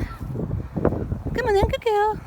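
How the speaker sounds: slow, very high drawn-out dog talk; coaxing the dog. Real, not acted. Owner coaxing her dog, very high slow coaxing.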